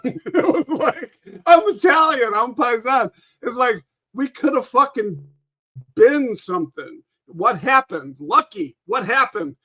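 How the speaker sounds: mock Italian gangster. Mock Italian mobster lament.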